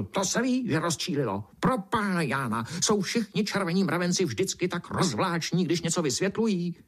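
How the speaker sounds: high, exasperated beetle; sputtering beetle. A narrator's reading. Beetle fed up with rambling ants, sputtering. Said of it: "černý brouk prskl".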